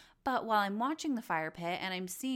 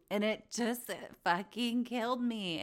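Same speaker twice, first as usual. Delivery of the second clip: normal talking voice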